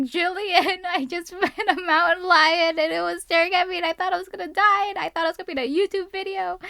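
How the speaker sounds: high, fast, breathless retelling of the panic; panicked and laughing. Retold or imitated. Hiker calling her friend Jillian after the mountain lion, high and frantic. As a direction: rush it out half laughing, half scared.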